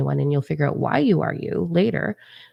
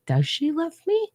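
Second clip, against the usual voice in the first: much higher-pitched, acted-out wondering; doubting child's question; 12 semitones higher than usual. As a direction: ask it high, like a doubting child. Child doubting her mother's love.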